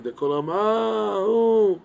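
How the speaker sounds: a high, loud, drawn-out lament "ah, oh"; mock wail. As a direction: cry out like the mourning crowd, hammed-up. Acting out the nation's wailing.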